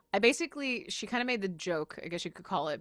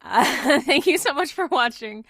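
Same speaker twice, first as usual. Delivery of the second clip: laughing; 7 semitones higher, 14 dB louder than usual. Real, not acted. Loud laughing sign-off.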